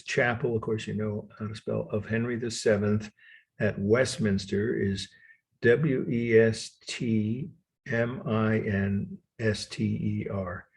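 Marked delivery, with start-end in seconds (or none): 5.6-10.7 letter by letter, with drawn-out pacing; slow spelling. Shown as a demonstration. Lecturer spelling a hard word for students, slowly. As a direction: spell it slowly in small groups. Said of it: "let me spell those words".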